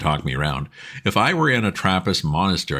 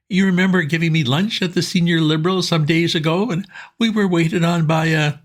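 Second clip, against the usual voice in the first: put-on much higher, earnest young chap; pleading pal, keen and urgent; eager Bingo; 11 semitones higher, 4 dB louder than usual. A narrator's reading. Bingo asking his old friend Bertie for help, eager and high.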